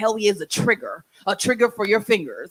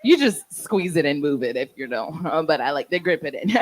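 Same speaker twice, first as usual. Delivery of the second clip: ordinary delivery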